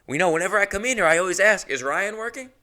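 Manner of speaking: high mock voice of the guy; imitating the guy. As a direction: lightly mimic him. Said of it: "he goes".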